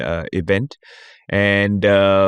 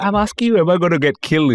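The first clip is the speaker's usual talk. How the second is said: high, anxious mock plea; hammed-up worried question; mock panic; 9 semitones higher, faster than usual. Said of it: "I'm like".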